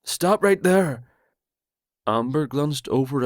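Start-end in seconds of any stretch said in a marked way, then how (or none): 0.0-1.0 higher, louder barked order from the dark; called out command. Acted. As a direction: call out a stern command.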